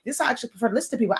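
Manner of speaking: very fast demo of her natural speed; showing off her real speed, very fast; natural fast talk. Shown as a demonstration. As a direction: rush it out the way she really talks. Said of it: "But this is how I talk".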